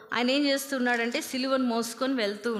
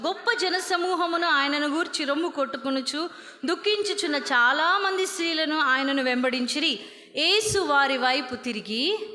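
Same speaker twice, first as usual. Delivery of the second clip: scripture reading. Acted. Preacher reads Luke to the women, measured and solemn. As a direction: read the verse out to the congregation.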